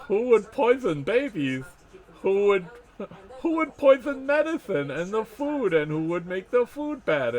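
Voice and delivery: high, loud hammed-up indignation; theatrical mock outrage, high-pitched; mock self-righteous villain. Rants as the mocked rulers.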